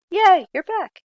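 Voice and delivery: high, loud French-free cheer of delight; excited cheer. Pretend. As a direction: cheer like greeting returning kids. Students return from pause.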